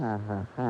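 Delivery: low, soft mocking ha ha ha; scornful spelled-out laugh; sarcastic fake laugh. Sarcastic laugh mid-quarrel.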